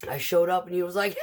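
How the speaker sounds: usual speaking voice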